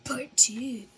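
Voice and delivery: slow hushed title whisper; whispered announcement. Acted. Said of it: "whispered title announcement".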